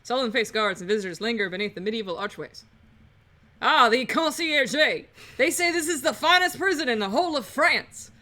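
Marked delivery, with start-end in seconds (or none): 3.6-8.1 lightly grand New Orleans lawyer; admiring lawyer Falcon. Acted. Lawyer praising the prison to his assistant, lightly hammed-up. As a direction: proclaim it like a proud tour guide.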